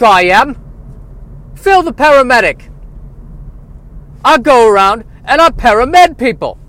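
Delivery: high, loud Irish paramedic voice; brash Irish paramedic; Irish accent. Acted. Phil character voice.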